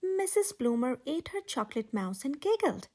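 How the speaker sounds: plain reading voice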